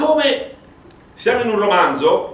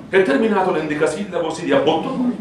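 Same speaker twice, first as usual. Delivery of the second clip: ordinary delivery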